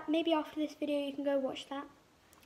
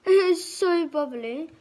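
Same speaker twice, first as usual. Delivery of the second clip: lips stiff under wet mask; small careful mouth movements; wearing a face sheet mask; 6 dB louder than usual. Real, not acted. Seen 3: Sheet mask covers whole face and lips during all speech; R frames unmasked.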